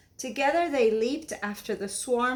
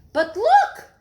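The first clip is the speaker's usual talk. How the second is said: high, loud exclamation; sudden high reveal; surprised; 13 semitones higher, 11 dB louder than usual. A narrator's reading. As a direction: gasp it to the children.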